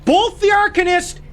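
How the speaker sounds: very high, loud enraged yell; furious screaming. Real, not acted. Gamer screaming at the game for switching characters, furious high yell. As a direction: scream at the game in real fury.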